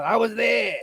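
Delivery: a light try at a Boston accent, drifting New York; I was there said in a Boston accent, rather New Yorker; attempted Boston accent. Shown as a demonstration. Practising a Boston accent with friends, slightly New York. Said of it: "That's more New Yorker, but I'm trying".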